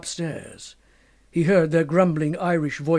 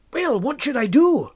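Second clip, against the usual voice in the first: anxious question; 8 semitones higher, 5 dB louder than usual. Acted. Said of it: "asked Fettes".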